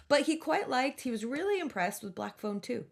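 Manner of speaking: normal talking voice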